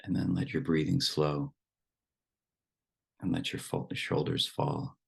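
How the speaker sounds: unhurried meditation guidance with long silences; guided meditation. Acted. Teacher settles the group into relaxed breathing, guided meditation voice. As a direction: ease them into stillness, phrase by phrase.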